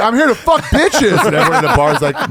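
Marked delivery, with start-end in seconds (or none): 0.0-1.3 high, slow boastful announcement; hyped bender guy. Retold or imitated. Bender guy announces himself to bar, comically hyped. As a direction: imitate a rowdy reveller, pitched way up.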